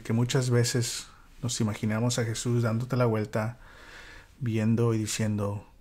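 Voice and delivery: normal talking voice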